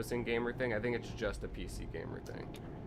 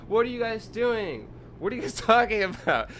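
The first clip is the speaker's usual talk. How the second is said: exasperated yell; 12 semitones higher, 9 dB louder than usual. Retold or imitated. Gamer yelling at forum trolls, loud and laughing in disbelief. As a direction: shout it in baffled outrage, breaking into a laugh. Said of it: "And I was like".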